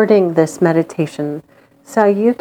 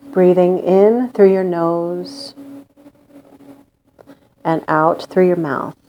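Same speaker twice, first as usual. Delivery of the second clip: guided meditation. Acted. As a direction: lull them into calm with long pauses.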